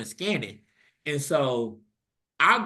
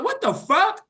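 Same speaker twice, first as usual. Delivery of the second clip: high, loud shocked squawk; shocked outburst; 6 dB louder, slower than usual. Retold or imitated. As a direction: squeal it in disbelief. Sister shocked on speakerphone.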